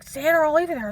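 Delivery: furious officer's ultimatum, reenacted; angry threat. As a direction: bark the ultimatum. Cop threatening her, retold.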